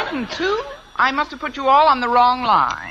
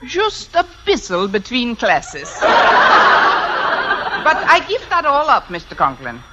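drawn-out mock-German excuse; put-on German accent; slower than usual. Fake applicant excusing her drinking, put-on German accent. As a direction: play it down in a hammed-up German accent. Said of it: "If I can do a decent German accent".

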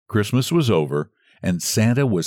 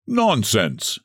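ordinary delivery, nothing marked

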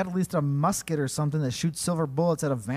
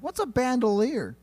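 confused guy; 10 semitones higher, 5 dB louder than usual. Retold or imitated.

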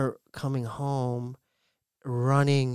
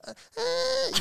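mock orgasm moan; 4 dB quieter than usual. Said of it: "so I was like".

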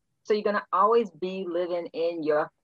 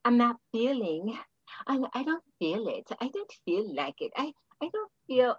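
mocking whine; 4 semitones higher, 3 dB quieter than usual. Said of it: "you said the key word".

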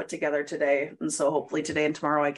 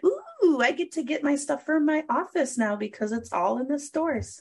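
excited; 9 semitones higher than usual. Retold or imitated. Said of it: "I'm like".

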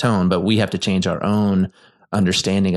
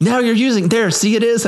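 much higher and louder, triumphant gotcha; excited gotcha; 14 semitones higher, 3 dB louder than usual. Real, not acted. Catching his wife using the tone, excited and high. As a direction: pounce on the moment, voice jumping high.

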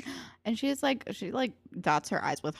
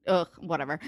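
ordinary delivery

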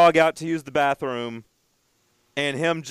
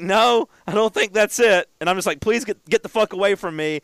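retold freak-out; 6 semitones higher, 4 dB louder than usual. Owner rebuffs stranger over hurt dog, freaking out. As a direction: act out a rattled brush-off. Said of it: "'Cause I'm, like, freaking out".

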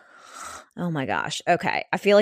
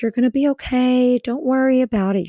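reassuring; 8 semitones higher, 6 dB louder than usual. Retold or imitated. Older woman comforting her.

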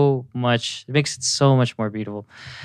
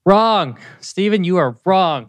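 loud, higher mock game-show verdict; playful verdict; 7 semitones higher, 5 dB louder than usual. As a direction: announce it like a quiz host buzzer. Game host declaring wrong answer.

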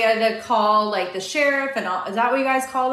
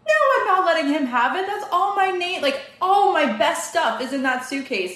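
higher, fast stubborn insistence; mock indignant; 7 semitones higher than usual. Wife retells arguing with her husband over the suitcase, fast high insistence. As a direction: rattle it off, refusing to give in.

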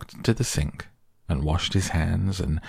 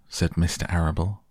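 usual speaking voice

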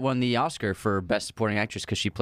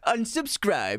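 high troll voice, quicker; internet troll; 10 semitones higher, much slower than usual. Pretend. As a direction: snap it like a sulking troll. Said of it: "The troll voice".

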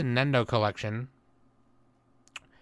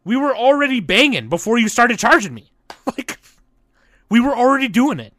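mock outraged shout; 10 semitones higher, 9 dB louder than usual. Joking outrage, raised voice.

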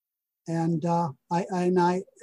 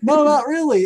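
higher, louder "No, not really"; loud emphatic repeat; 7 semitones higher, 8 dB louder than usual. Real, not acted. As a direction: say it again, clearly louder.

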